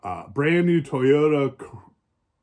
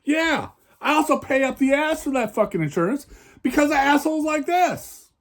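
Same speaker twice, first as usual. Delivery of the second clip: heated, much higher rant; angry; 10 semitones higher than usual. Real, not acted. Complaining about insurance costs to viewers, angry and higher. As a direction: let the anger about paying for insurance rise. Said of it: "just a wee bit angry about it".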